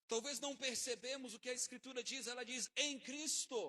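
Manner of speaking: high and loud proclamation; preaching climax. Acted. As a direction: shout the scripture to the crowd.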